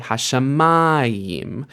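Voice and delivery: HashaMAyim with a long, slow "ma"; Hashamayim, beat on "ma", not the end; penultimate stress. Shown as a demonstration. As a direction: stretch the "ma" in Hashamayim. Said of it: "It's actually penultimate stress there".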